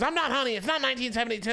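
high-pitched, offended woman's objection; indignant retort. Retold or imitated. Retold offended woman snapping back.

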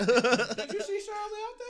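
high, slow, squeaky mock voice; high put-on voice. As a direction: put on a silly high voice to tease. Mock quote to other drivers.

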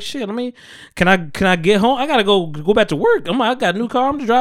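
half-mock nervous patient; retold nerves. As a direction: sound jittery about driving home. Nervous after the blood draw.

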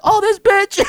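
2 high, amazed grudging outrage; 3 half-impressed fury at her nerve; 1 exasperated disbelief. Retold or imitated. 4 man realizing his ex outplayed him, high exasperation.

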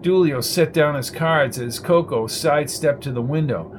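plain reading voice

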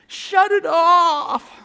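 high, drawn-out angry shout; yelling. Acted. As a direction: yell it as if blinded by a glaring light. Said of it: "they're yelling at us like".

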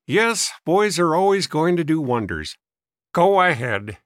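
scoffing, crotchety dismissal; mock their hopes like a sour old man; testy old man. A narrator's reading. Old man doubting the boys, scornful.

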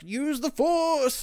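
high, very slow hammed-up villain cry; mock Sith Lord. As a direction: ham up an evil Sith command. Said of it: "dark Sith Lord, just like".

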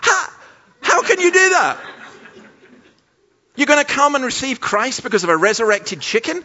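high, loud incredulous protest; hammed-up outraged questioning; exasperated disbelief. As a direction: object as if utterly appalled. Appalled at the altar call.

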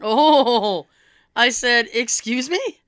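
usual speaking voice

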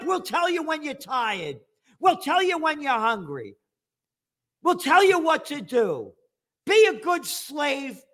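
squeaky parody of a ruler giving orders; mock authority voice. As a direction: sneer like a patronizing ruler. Mocking voice of rulers.

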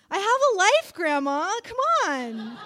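loud, high protest with a laugh; laughing indignant cry to grandma; exasperated protest. Acted. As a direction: push back at grandma, half laughing.

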